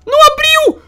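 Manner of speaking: very high, loud alarmed cry; shocked cry. Real, not acted. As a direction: yelp it in disbelief. Door fails to open.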